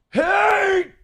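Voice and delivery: high sharp cry of pain; sudden pained yelp. Real, not acted. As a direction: yelp as the tooth hurts. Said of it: "I shouldn't do that. That fucking hurts".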